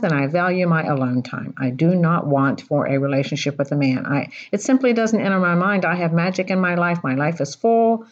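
usual speaking voice

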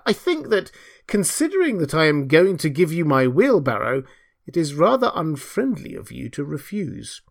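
pompous, self-righteous voice; reproachful miller. Acted. Miller chiding Hans for refusing, reproachful. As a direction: chide with injured dignity.

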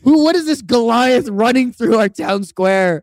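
high, slowed bewildered cry; astonished onlooker. Pretend. Townsfolk gawking at a giant, high and slowed. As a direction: marvel at a passing giant.

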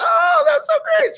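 hammed-up giddy girl; squealing girl. Storyteller voicing the playmate after the kick, squealing.

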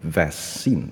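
vaccine slowly as ve-SEEN, no k, end stressed; tongue-tip mispronunciation. Shown as a demonstration. Teacher explaining the students' vaccine, slow tongue-tip wrong version. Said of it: "A C那个音是在舌尖".

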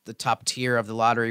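ordinary talk, nothing marked

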